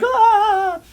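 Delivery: very high, loud comic shriek of fright; startled scream. Retold or imitated. Crew startled by the whale's giant eye, loud shriek. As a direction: shriek like a cartoon character caught off guard.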